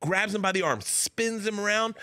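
normal talking voice

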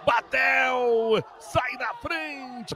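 stretched, high goal celebration; excited goal call. Real, not acted. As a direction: draw out the lead call. (comemoração empolgada do gol)

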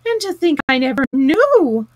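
loud, with a high swoop on knew; swooning amazement; rapturous wonder. A narrator's reading. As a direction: marvel at it, stretching out knew. Toad's rapturous monologue continues.

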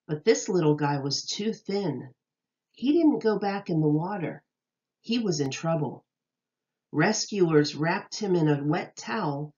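bright, lilting read-aloud voice; storybook reading voice; reading to children. Acted. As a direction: read it like a picture book to little kids. Reads picture book to children.

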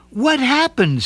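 put-on startled girl; surprised. A narrator's reading. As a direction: ask in dismay. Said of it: "said Nancy".

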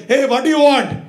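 put-on high, loud, curt barber with an accent; gruff old barber. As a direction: bark it like an impatient old barber. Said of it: "it sounded like my grandpa".

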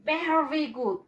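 normal talking voice

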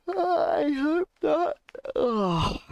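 high, slow hammed-up wail; fake-sob at the idea; mock crying. Streamer mock-wailing about eating on stream, hammed-up.

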